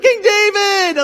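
high, loud, slow starstruck call; King David called out, drawn out and thrilled; excited shout. Pretend. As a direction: call out to a hero across a crowd.

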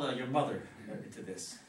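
normal talking voice

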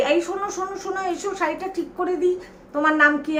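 high-pitched imitation of a helpful neighbour; calling a child over. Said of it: "একটা বাড়ি থেকে দেখে ডেকে". (বাচ্চাকে ডাকার নকল, চড়া সুরে)

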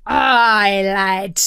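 loud, drawn-out pirate voice; hearty pirate reply; pirate captain. A narrator's reading. Pirate captain answers the boy.

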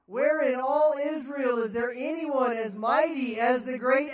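high, loud and slow, mocking; David shouting scorn at Abner; loud taunting shout. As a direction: rub his failure in his face.

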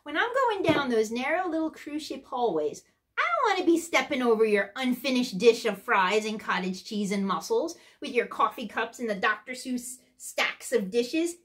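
normal talking voice

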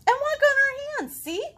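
very high, loud, fast; excited kid. Acted. Girl in the book listing fun things, very high and fast. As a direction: rattle it off breathlessly. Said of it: "girl boasts".

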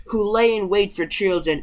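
usual speaking voice

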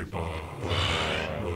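low-pitched manly bye; a playfully macho farewell; manly goodbye. Shown as a demonstration. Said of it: "gotta say bye, manly way".

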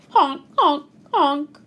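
short imitated goose honks. A narrator's reading. Geese smoothing things over, short honks. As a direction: honk like a goose, in separate bursts.

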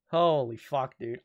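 usual speaking voice

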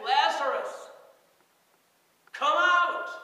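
a huge, high commanding shout; Jesus calling into the tomb, a booming high shout; loud cry. Acted. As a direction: call him out of the tomb at full voice. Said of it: "cries out in a voice loud enough to raise the dead".

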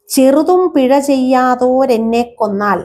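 lifted, lilting verse line; verse recitation. Shown as a demonstration. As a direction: recite the padam line like a teacher. Teacher recites Kathakali verse.

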